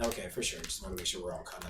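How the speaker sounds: soft mumbled aside; quiet aside. Real, not acted. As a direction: say it under your breath.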